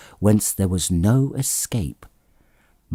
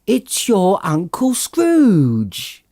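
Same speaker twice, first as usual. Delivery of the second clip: much higher, slow, savoured announcement; triumphant reveal; 10 semitones higher, 4 dB louder, much slower than usual. A narrator's reading. Plump sister revealing the answer to everyone, high and slow. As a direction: draw out the answer gleefully.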